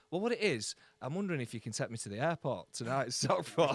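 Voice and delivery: loud, sheepish wheedling ask with a laugh; mock favour-asker. Mimicking a friend who wants a lift to the airport, loud and wheedling. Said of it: "And they're like".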